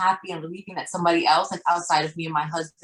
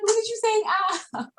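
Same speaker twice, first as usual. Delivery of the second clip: much higher, delighted squeal of praise; excited, happy. Retold or imitated. Mother praising her son's first ow, much higher and delighted. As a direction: gush over the child's new sound. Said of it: "I was just so happy".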